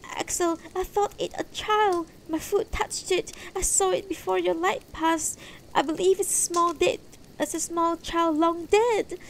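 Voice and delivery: high-pitched woman's voice, urgent; distressed wife. Acted. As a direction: plead in a high, upset voice. Distressed wife thinks child dead.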